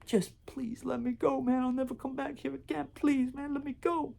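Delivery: high, begging plea; pleading. Retold or imitated. Robber on his knees begging to go, high and pleading. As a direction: beg for your life in a thin high voice. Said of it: "this guy is pleading".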